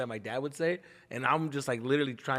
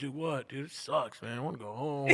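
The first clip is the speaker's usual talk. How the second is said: playing dumb; 6 dB quieter, slower than usual. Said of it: "acting like I don't know".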